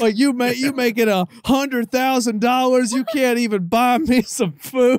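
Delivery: loud, high put-on voice of an offended old man; imitating his dad. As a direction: play the indignant father ranting on the phone. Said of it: "Is that his accent too?".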